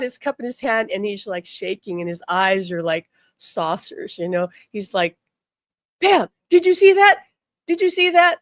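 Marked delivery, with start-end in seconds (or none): 6.0-8.4 high, loud, urgent; stunned exclamation. Retold or imitated. Husband at breakfast to his wife, shocked and loud. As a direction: blurt it out in shock, much higher and louder.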